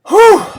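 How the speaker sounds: high exaggerated whew of relief; mock relief after boring figures; mock relieved whew. Real, not acted. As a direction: blow out a big comic whew. Relief after reading numbers.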